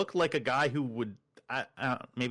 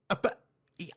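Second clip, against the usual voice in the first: normal talking voice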